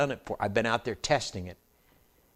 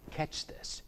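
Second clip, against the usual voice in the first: breathy aside, nearly silent; whispered aside; 9 dB quieter than usual. Real, not acted. Preacher whispering catch this to congregation, hushed. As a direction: hush it to draw them in.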